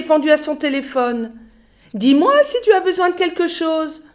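very high, loud, giggling brush-off; chatty secretary. A narrator's reading. Secretary dismissing the boy on the phone, high, loud and giggling. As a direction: laugh him off breezily. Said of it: "C'était Grunda, la secrétaire".

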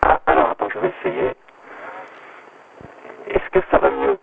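glitchy robotic phone line; distorted digital phone voice. Real, not acted. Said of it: "Tu as une voix de robot".

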